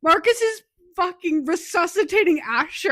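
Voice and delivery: reacting to a shocking book scene, loudly; excited outburst. Real, not acted. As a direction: blurt it out in disbelief. Frantic retelling of book climax.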